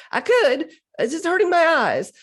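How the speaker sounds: high, loud laugh through the words; giggling about tired eyes. Real, not acted. Laughs at own eye strain.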